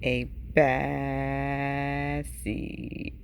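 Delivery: very low, stretched-out menace; ominous. A narrator's reading. The seed names himself a bad seed, low ominous drawl. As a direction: hold BAD long and deep.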